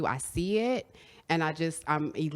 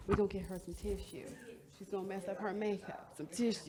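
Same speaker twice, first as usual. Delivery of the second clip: quiet aside; 11 dB quieter, faster than usual. Real, not acted.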